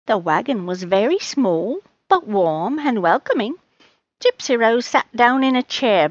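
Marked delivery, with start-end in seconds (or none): none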